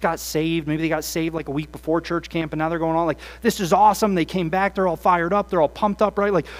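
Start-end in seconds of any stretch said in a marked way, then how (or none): 3.4-4.1 mock excited teen. Fired-up camp students.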